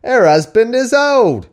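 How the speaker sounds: high, loud, drawn-out Cockney shout; over-the-top Cockney holler; mock Cockney. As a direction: belt it out as a hammed-up Cockney. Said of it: "I'm going Cockney".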